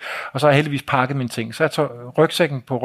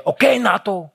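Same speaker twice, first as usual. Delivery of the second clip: high, insistent heckle; imitating the brother's goading, pitched well up; storebror's taunting; 7 semitones higher than usual. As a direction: keep pestering the guest in a high voice. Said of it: "Og storebroren sidder og siger".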